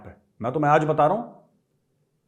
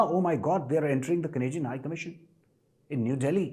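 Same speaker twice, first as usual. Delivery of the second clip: soft, slow, mocking the panicked foreigner; 8 dB quieter, much slower than usual. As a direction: imitate a fussy official sneeringly, slowly and softer. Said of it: "फिर ये मत बोलना". (धीमी, नरम, चिढ़ाती हुई नकल)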